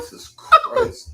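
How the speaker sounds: high squealing laugh through words; cracking up in disbelief. Real, not acted. Reacting to shocking lyric.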